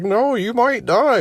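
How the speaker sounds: high, slow mock panic; worried ex. Ex refuses to let him shower first, high mock worry. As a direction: plead it up high like she's scared for him. Ex insists on hospital.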